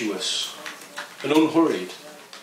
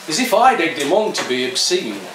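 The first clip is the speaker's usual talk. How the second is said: ordinary delivery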